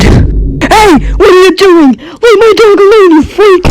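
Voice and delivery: high-pitched outraged yell; indignant shout. Acted. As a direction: yell to stop someone hurting your dog. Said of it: "and then I shouted".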